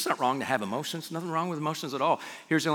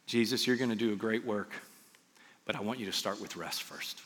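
soft and lowered, settling quieter; gentle fatherly counsel; 5 semitones lower, 9 dB quieter than usual. Acted. God to Jesus before his great work, gentle counsel. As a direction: counsel quietly, with a pause midway.

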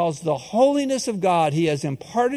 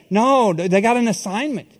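normal talking voice

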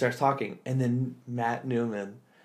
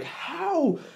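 high squeak of disbelief; incredulous high how; amazed how. Real, not acted. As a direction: pitch it way up in amazement. Amazed at improv performers.

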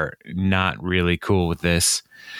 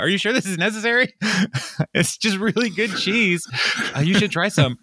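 high, fast, nervous pleading; hammed-up panic over the cheese; mock nervous plea; 10 semitones higher than usual. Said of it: "He's like".

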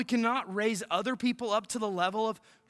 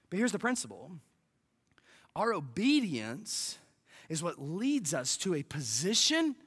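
ordinary delivery

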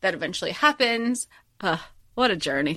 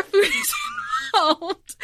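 laughing. Real, not acted. Laughing at own confession.

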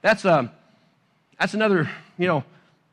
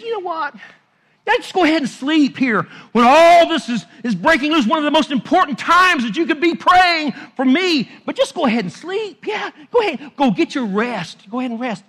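higher, louder, exasperated go-ahead-and-sleep; frustrated sarcasm; 7 semitones higher, 6 dB louder than usual. Retold or imitated. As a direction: let the disappointment bite, sarcastic. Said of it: "He was frustrated at him".

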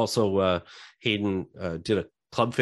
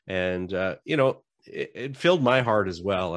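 normal talking voice

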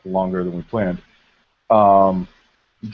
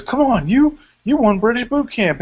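Dixie Carter pep talk; 12 semitones higher than usual. Retold or imitated. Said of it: "she, like, says".

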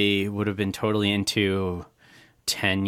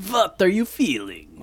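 higher vampire voice, Transylvanian accent; 8 semitones higher than usual. Shown as a demonstration. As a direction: ask it like a Transylvanian count. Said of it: "sounding like a vampire".

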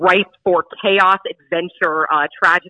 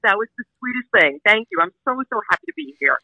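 crying; 7 semitones higher, 3 dB louder than usual. Real, not acted. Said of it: "I'm sorry, I'm crying now".

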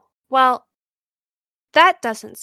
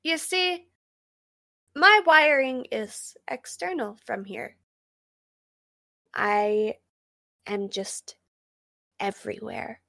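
much softer, drifting off eerily; eerie quiet; 2 semitones lower, 6 dB quieter than usual. Acted. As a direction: fade into a strange hush. Computer getting eerie.

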